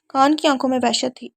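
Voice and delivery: plain reading voice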